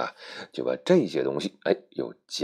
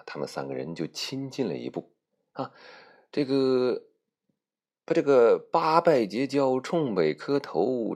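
plain reading voice

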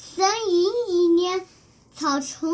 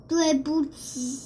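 ordinary delivery, nothing marked

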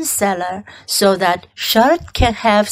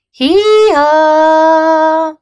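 louder, higher bleat stretched long; sheep bleating in chorus; 6 semitones higher, 6 dB louder than usual. Acted. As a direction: bray it out like a flock. Lambs answer all together.

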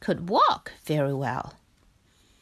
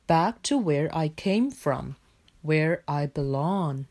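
lightly voiced bear, a little lowered; a hint of a resolute bear; Bear character voice; 4 semitones lower than usual. A narrator's reading. Bear explains his leaving to Frog, steady bear voice.

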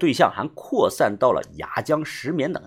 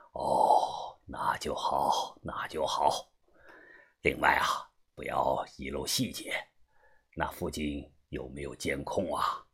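very low, soft, measured boss; deep hushed voice checking on details; old gang boss, relieved then cautious; 10 semitones lower, 10 dB quieter, slower than usual. Acted. (把头低沉谨慎地叮嘱)

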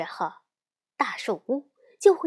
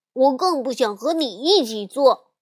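raised, sulky retort of a brother; huffy anger; 2 semitones higher, 5 dB louder than usual. A narrator's reading. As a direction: snap back at your sister in a huff. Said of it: "气哼哼地往后排走去". (气哼哼地提高声音顶嘴)

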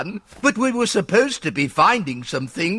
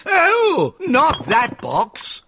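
alarmed protest; 7 semitones higher, slower than usual. Acted. Protecting her box.

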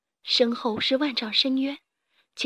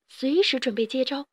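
usual speaking voice

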